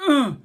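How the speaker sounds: sudden loud startled grunt; collision grunt. Acted. Boy slams into the cook, loud startled ugh. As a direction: yelp as you crash into someone.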